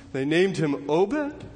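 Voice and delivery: slow question, "Obed" rising at the end; puzzled disbelief. Acted. Listeners hearing the boy's name, puzzled. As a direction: query the name "Obed" with a drawn-out upward lift. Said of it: "And you're thinking".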